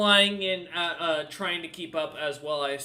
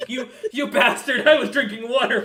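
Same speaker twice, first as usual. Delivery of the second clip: laughing; 7 semitones higher, 11 dB louder than usual. Real, not acted. GM cracks up at joke.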